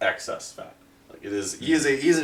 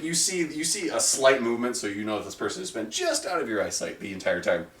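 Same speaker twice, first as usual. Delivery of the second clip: ordinary talk, nothing marked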